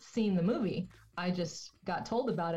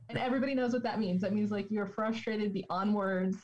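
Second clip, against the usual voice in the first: normal talking voice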